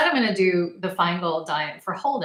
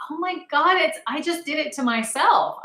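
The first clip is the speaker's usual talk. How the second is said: high, louder oh my God dawning; sudden realization; 10 semitones higher, 5 dB louder than usual. Retold or imitated. Realizing the salicylates caused it, a high surprised oh my God. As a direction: struck by what she did to herself. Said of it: "I was like, immediately like".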